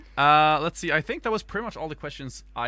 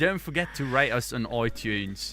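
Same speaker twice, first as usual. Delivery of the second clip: put-on British podcast intro; exaggerated British host welcome; British accent; 3 semitones higher than usual. Host opening an all-British podcast, put-on accent. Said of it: "we only get to talk British English".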